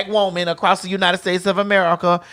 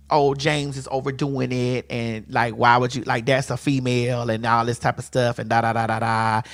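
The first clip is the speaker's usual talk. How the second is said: low, softer mock-quoting; dismissive mimicry; 7 semitones lower, 5 dB quieter than usual. Host quoting online comments about James, dismissive. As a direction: reel off the complaints dismissively in a dropped voice.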